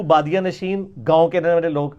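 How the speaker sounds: ordinary delivery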